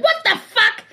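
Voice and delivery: high, startled what the fuck; startled exclaim. Retold or imitated. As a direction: blurt it out in shock. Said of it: "I'd be like".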